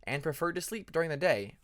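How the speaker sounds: ordinary delivery, nothing marked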